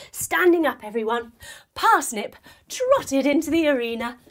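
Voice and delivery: ordinary delivery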